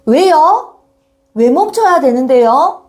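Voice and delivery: acted schoolkid protest with rising ending; challenging pupil. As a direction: talk back to the teacher, asking why. Pupil questioning teacher's order.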